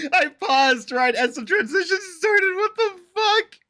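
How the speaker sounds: loud laughing, words squeezed out high; laughing too hard to talk; real laughter. Real, not acted. A viewer cracking up at a paused frame, laughing loud.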